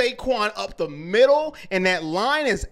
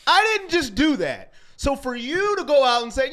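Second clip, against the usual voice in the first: normal talking voice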